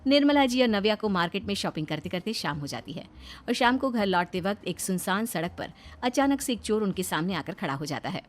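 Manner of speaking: plain reading voice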